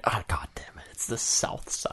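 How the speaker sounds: soft, breathy muttered aside; whispered grumble about the South Side; hushed muttering. Real, not acted. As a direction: say it under your breath. Muttered aside about Pittsburgh talk.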